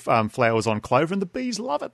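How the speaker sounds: ordinary delivery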